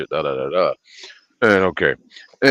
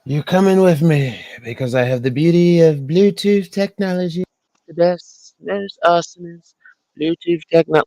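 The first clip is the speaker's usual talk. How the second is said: much higher, louder cartoon voice; squeaky silly voice; 11 semitones higher, 8 dB louder than usual. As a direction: play the talking gadget with a squeaky goofy voice. Jokey voice for the Bluetooth speaker.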